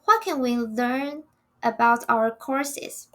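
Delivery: normal talking voice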